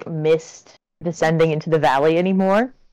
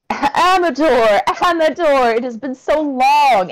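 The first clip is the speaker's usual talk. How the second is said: excited greeting; 6 semitones higher, 4 dB louder than usual. Acted. Vaughn greets an old friend.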